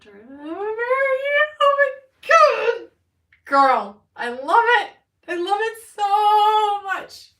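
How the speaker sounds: squealing high and very loud; ecstatic. Real, not acted. Card reader finds her family name Mary, ecstatic. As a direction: shriek with delight, stretching the words out.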